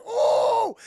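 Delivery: loud high mock gasp-shout; shocked yelp. Heroes seeing Vader at the table, loud shocked yelp.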